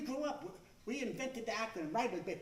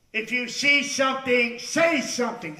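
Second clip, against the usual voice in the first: slow, booming announcement voice, only lightly voiced; Darth Vader; 3 semitones higher, 14 dB louder, slower than usual. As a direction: intone it like an imposing masked villain over the speaker. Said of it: "he sounded just like Darth Vader".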